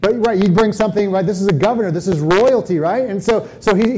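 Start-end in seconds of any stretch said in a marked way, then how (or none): none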